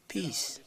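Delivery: peace set apart by pauses, pitched high and falling; single out "peace" with a high falling tone and a pause after; stress on peace. Real, not acted. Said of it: "I stress the word peace".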